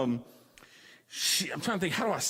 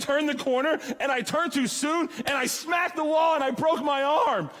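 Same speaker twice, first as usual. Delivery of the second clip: mock shout rising higher; imitating a loud date; 7 semitones higher than usual. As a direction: shout the crash out, pitched up. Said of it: "she said".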